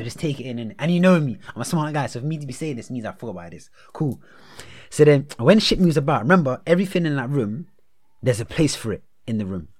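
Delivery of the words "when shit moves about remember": ordinary talk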